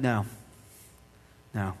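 a gentle, hushed voice of God, fading almost to a whisper on sinners; calm quiet refusal; God speaking softly. Acted. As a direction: speak as God, low and quiet, holding back the angels. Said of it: "And God said".

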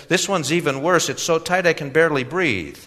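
put-on high, complaining young man; complaining young customer. A narrator's reading.

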